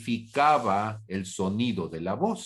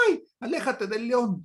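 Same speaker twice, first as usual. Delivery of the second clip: mock frightened crowd; 11 semitones higher than usual. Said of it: "y todo el mundo dice".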